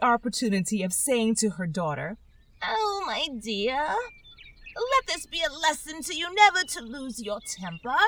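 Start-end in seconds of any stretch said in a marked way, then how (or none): 2.6-8.0 put-on old mother crab. Old crab scolding her daughter, fussy and high.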